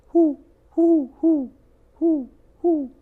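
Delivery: high, loud hooting whos; owl hooting. Acted. The great horned owl hooting at the skunk, high and loud.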